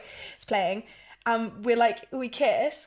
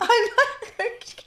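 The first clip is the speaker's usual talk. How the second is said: laughing, emotional; 12 semitones higher, 4 dB louder, slower than usual. Real, not acted. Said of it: "getting emotional right now".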